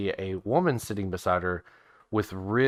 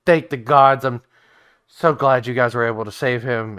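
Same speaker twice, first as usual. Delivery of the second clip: relieved, higher and louder thanks; relieved Marie; 3 semitones higher, 7 dB louder than usual. Acted. Wife thanking the party for saving Raz, relieved and gushing. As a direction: gush with relief as the worried wife. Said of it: "Marie goes".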